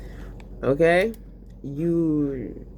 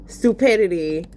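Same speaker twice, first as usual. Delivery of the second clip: ordinary talk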